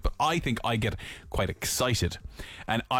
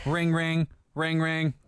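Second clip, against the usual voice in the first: casual phone ring; 5 semitones higher, slower than usual. Pretend. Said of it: "Wait for the difference".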